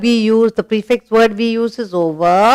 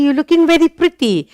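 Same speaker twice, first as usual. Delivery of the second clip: light, quick, lifted compliment; compliment demo; 7 semitones higher, faster than usual. Shown as a demonstration. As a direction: sound pleased with them. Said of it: "I tell you something and you react".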